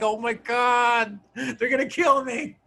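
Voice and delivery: slow, high-pitched mock terror; mock panic. Boy with spiders crawling on his arms, mock panic. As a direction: draw it out in hammed-up dread.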